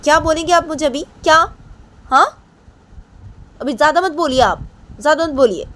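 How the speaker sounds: very high, loud angry scolding; Payal angry. Acted. Payal tells Poonam off, loud and high. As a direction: shut her down loudly and sharply. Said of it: "तो पायल पूनम को बोलती है".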